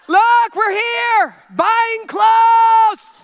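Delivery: high, loud, laughing hammed-up cheer; mock giddy bridesmaid. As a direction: parody a gushing post. Mocking bridesmaids' social post.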